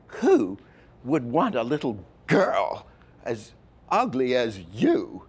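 1 harsh mocking woman. Voicing the angry character.

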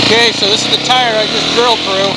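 talking inside a helmet over wind. Real, not acted. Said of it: "I'm gonna put my helmet on".

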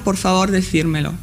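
normal talking voice

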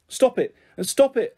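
high-pitched frantic protest. A narrator's reading. Girl clutching her tugged skirt, frantic. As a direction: plead frantically for it to stop. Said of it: "I said, frantic".

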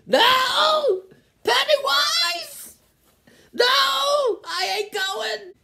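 high screaming no, hammed-up panic; mock terrified scream. Seeing Pennywise with a burger, hammed-up terrified scream. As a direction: shriek like a horror victim.

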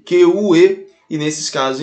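ordinary talk, nothing marked

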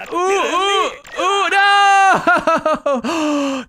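loud, high wailed no; drawn-out yell of defeat; dismayed cry. Real, not acted. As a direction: cry out as the game goes wrong. Losing in the game.

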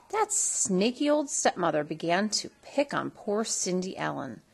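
usual speaking voice

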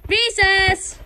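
high, loud shout; loud call. Pretend. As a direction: yell it across the schoolyard. Calling out recess.

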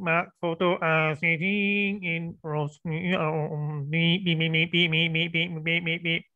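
slow, monotone robot reading with beeps. Retold or imitated. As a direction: imitate a robot reading aloud. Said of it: "Robot voice".

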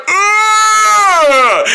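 high, drawn-out creature groan; exaggerated long moan; imitated yawn. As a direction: mimic a gator's big yawn. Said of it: "He went like".

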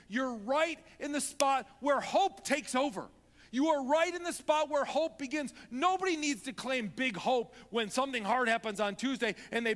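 loud, high, pleading preacher's cry; impassioned sermon climax; preaching at full force. Acted. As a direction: urge them on at full voice.

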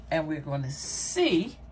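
ordinary talk, nothing marked

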